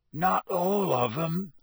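slow, hoarse rumble. A narrator's reading. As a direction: rasp it sadly. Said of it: "said Hagrid hoarsely".

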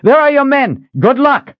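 high, loud parting shout; calling out. A narrator's reading. Rider pointing rescuers toward their men, calling out. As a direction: send them off with a shout.

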